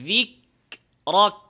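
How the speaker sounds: রিক, রক with a clear puff of air on the k; correct pronunciation. Shown as a demonstration. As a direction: say রিক, রক with the breath released after the k. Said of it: "তো সঠিক উচ্চারণ". (হামস সহ সঠিক উচ্চারণ)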